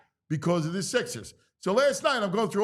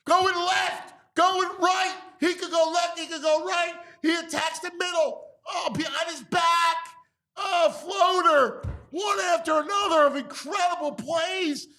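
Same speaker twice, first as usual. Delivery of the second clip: excited; 9 semitones higher than usual. Real, not acted. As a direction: rave like a stunned fan. Raving over highlights.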